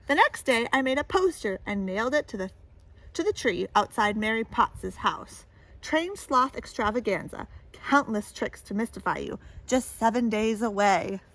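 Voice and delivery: storybook read-aloud voice; lively picture-book reading; story narration. A narrator's reading. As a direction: tell it to a child at bedtime.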